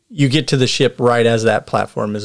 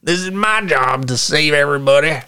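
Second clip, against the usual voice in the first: higher indignant Southern voice; the man guarding his ship, scolding the climber; Southern NPC; 3 semitones higher than usual. Acted. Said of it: "And he's like".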